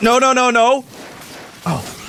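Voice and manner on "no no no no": panicked. Real, not acted. Panicking under fire.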